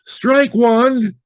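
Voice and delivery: high, loud umpire call; umpire's call. Acted. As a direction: bark it like an umpire. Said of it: "the umpire said".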